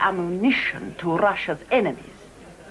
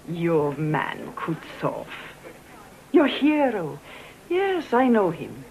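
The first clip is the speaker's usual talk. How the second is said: usual speaking voice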